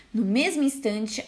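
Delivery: ordinary delivery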